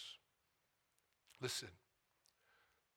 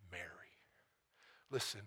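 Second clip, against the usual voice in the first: usual speaking voice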